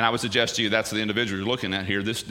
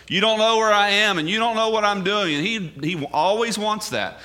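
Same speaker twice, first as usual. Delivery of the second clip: higher, driving sermon delivery; pitched up to press the point; impassioned preaching; 9 semitones higher, 3 dB louder than usual. Acted. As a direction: preach it with rising insistence. Preacher raises intensity.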